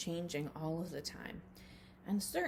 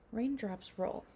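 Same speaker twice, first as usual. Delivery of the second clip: slow, hushed storybook reading with whispered lines; reading a picture book to children; 7 dB quieter than usual. Acted. Teacher reading a rain book to her class, hushed storybook voice. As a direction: read it gently to little ones, letting some lines fall to a whisper.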